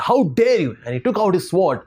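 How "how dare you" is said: higher-pitched, indignant retort from the king; angry king. A narrator's reading. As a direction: snap back, a touch of royal outrage. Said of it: "he became very angry".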